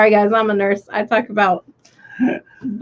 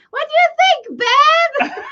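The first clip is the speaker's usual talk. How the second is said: very high, laughing imitation of a thick English accent; slower than usual. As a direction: mimic the English prankster wife teasing her husband. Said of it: "In that English thick accent".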